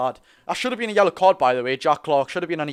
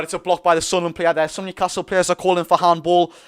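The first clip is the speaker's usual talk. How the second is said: ordinary talk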